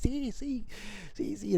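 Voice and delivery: high-pitched little cheers; playful, high cheering without volume; mock rejoicing. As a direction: celebrate like excited, dancing followers. Imitates disciples dancing and rejoicing.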